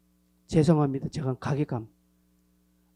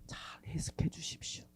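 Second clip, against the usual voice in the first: whisper; 3 semitones lower, 14 dB quieter than usual. Real, not acted. Whispered plea to congregation.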